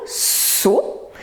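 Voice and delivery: SO with a long hissed S; SO as one drawn-out syllable; syllable repeated. Shown as a demonstration. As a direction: stretch the S of SO before the vowel. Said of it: "il répète la première syllabe".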